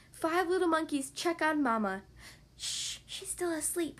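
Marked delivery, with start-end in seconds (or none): 2.6-4.0 hushed. Acted. Checking on sleeping Mama.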